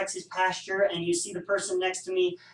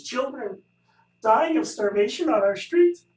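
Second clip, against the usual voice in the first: hammed-up choked-up plea, louder on the last words; mock emotional; 4 semitones higher, 6 dB louder than usual. Teacher acting out an emotional survey question, mock tearful. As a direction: play up the tragedy like a charity appeal, tongue in cheek. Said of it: "I'm sorry, I just get emotional".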